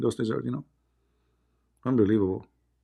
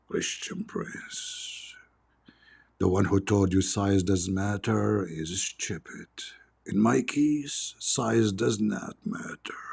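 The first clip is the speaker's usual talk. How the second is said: slow, breathy lizard whispering low; hissing lizard, whispered low and slow; creepy lizard whisper; 3 semitones lower, 6 dB quieter, much slower than usual. Acted. Lizard voiced in his story.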